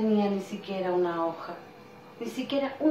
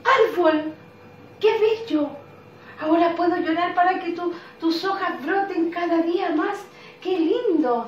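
happy cloud; 8 semitones higher, 6 dB louder than usual. Acted. Cloud character praises the tree.

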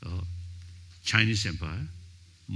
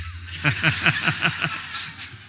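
breathy unvoiced chuckle, higher; real chuckle; 7 semitones higher than usual. Real, not acted. Amused at his own joke, a breathy chuckle. Joking about the lira.

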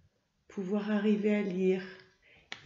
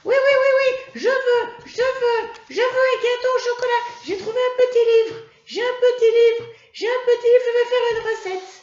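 put-on very high, loud, fast excited child puppet voice; Mimi the puppet; 11 dB louder, much faster than usual. Puppet pestering the teacher for chocolate cake, high excited squeal. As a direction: squeal like an eager little puppet begging for cake.

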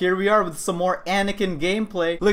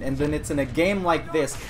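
ordinary talk, nothing marked

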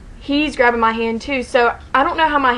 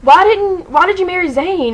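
high, indignant retold complaint; mock mad; 7 semitones higher, 4 dB louder than usual. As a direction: imitate a jealous, put-out protest. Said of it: "he was kinda mad".

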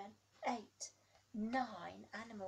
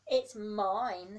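big booming elephant, much louder; 17 dB louder than usual. Acted. Elephant claiming its trunk, booming loud. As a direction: boom it out like a proud elephant. Elephant's line in picture book.

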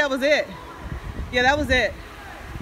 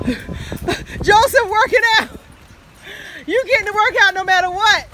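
laughing; 11 semitones higher, 5 dB louder than usual. Real, not acted. Laughing at struggling friend.

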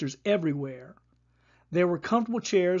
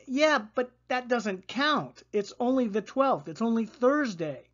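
put-on girl's objection; 6 semitones higher than usual. A narrator's reading. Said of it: "Kaya pointed out".

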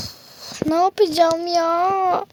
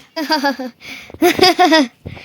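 evil laugh. Acted. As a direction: cackle like you've caught your prey. Villain catches the little bear.

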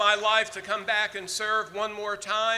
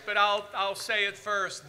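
normal talking voice

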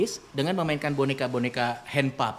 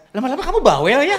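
puppet talking back; 10 semitones higher, 8 dB louder than usual. Shown as a demonstration. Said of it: "kita bisa lihat".

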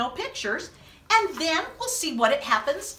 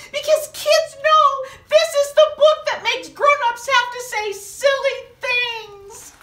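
much higher and louder mock complaint; playful protest; 8 semitones higher, 7 dB louder than usual. Storyteller teasing the kids who chose the book, mock squeaky protest.

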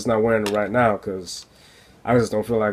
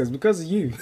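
Baltimore accent; 6 semitones higher than usual. Shown as a demonstration. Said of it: "I sounded like I was from Baltimore".

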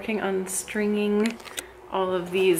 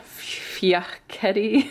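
fiocchetti whispered slowly, as if a swear word; whispered like a curse; much slower than usual. Real, not acted. Naming the pasta to viewers, fiocchetti whispered like a curse. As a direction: say fiocchetti under your breath like a naughty word. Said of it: "Feels like I'm cursing".

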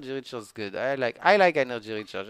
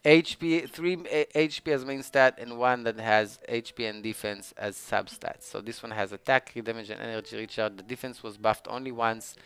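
ordinary talk, nothing marked

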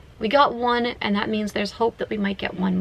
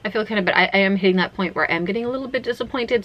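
ordinary delivery

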